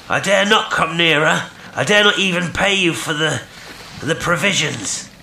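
high, halting call; pleading distant voice. A narrator's reading. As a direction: plead across the water, stumbling at the end. Stranger pleading from the boat.

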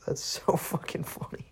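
can't stop laughing; cracking up mid-sentence. Real, not acted. Laughing at the story.

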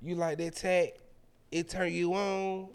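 slow, drawn-out mock come-on; mock-sultry questions, stretched at the end; seductive woman. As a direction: tease it out as her flirting. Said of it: "that's what she said".

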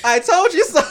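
high laughing I told you so. Retold or imitated. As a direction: repeat it cracking up. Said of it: "Mariam will tell you with love".

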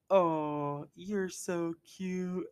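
high, slow, drawn-out gushing; exaggerated baby-talk fawning; mock cooing. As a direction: coo as if at something adorable, hammed-up. Acts Ernest cooing at the troll.

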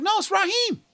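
high, light mock reply; amused correction. Raheem telling teachers who he is, high playful reply. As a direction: imitate him correcting the teachers. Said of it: "He's like".

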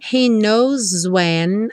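very slow, "knows" ending in Z; Z-linked knows. Shown as a demonstration. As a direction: buzz the S of "knows" into "when". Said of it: "o S ganhou um som de Z". (S de "knows" com som de Z)

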